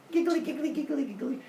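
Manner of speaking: high, chattering pretend giggle; mock giggling. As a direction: chant a little high-pitched sneaky titter. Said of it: "She backs away giggling".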